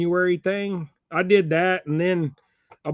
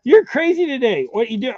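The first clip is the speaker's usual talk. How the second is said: much higher and louder pet voice; baby talk to dog; 8 semitones higher, 7 dB louder than usual. Acted. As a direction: gush at the silly dog. Talking to the dog.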